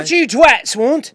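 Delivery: high, loud Aussie yell; mock Australian shout. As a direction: bark it like a rowdy Aussie bloke.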